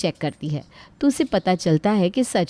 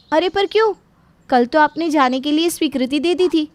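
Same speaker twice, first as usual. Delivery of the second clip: high-pitched, taken aback; surprised protest; 7 semitones higher than usual. Acted. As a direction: question the sudden ban in disbelief. Radha protests being stopped. (हैरान होकर ऊँची आवाज़ में विरोध)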